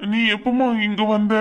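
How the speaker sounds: normal talking voice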